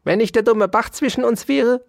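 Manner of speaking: high, spiteful scolding; venomous threat. A narrator's reading. Dauerspeck threatens the mouse across the brook, venomously. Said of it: "sagte Ambrosius Dauerspeck giftig".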